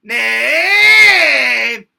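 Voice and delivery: high, loud scream with gravel; gravelly scream. Shown as a demonstration. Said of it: "I might do that with some gravel".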